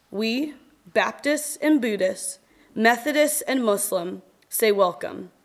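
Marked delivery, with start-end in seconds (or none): none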